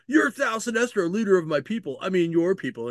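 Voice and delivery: high, excited character voice; excited acted address. Kid addresses Sinestro as his leader, high and excited. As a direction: pitch it up, hammed-up awe.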